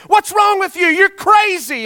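furious, very high yelling; angry shout. Retold or imitated. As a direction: roar at him after nearly drowning. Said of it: "he was angry, and he was huffing".

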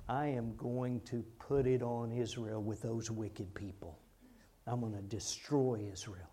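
low, soft, understated pronouncement; God. Retold or imitated. God announcing Israel's punishment, in a low hushed voice. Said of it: "And God says".

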